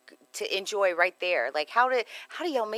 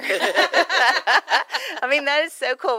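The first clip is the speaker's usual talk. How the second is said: laughing; 9 semitones higher, 10 dB louder, much slower than usual. Real, not acted. Host laughing at own joke.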